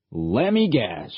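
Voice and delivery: slow, dry sarcastic drawl; mock him, dragging it out. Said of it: "said Kelly sarcastically".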